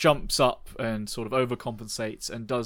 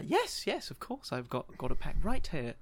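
put-on old lady voice; 2 semitones higher, 5 dB quieter than usual. GM voices Margaret.

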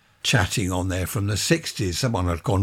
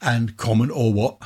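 mock common. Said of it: "I don't know why I keep saying or what".

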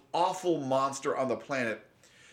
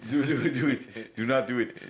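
hesitant mock Russian accent. Warming up for the oligarch bit, mock Russian accent. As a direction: try out a comic Russian accent. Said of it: "do the Russian accent".

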